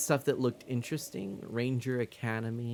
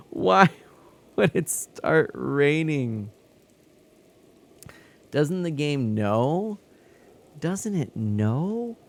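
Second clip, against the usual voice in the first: whiny complaint; 5 semitones higher, 6 dB louder, much slower than usual. Real, not acted. Complaining at the game.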